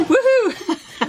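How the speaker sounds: cheering. Real, not acted. Cheering at baby.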